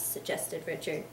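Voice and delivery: usual speaking voice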